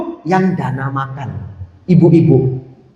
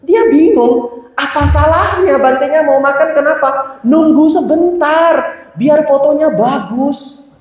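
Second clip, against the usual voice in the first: puzzled student; 9 semitones higher, slower than usual. Retold or imitated. Voicing the confused photographer.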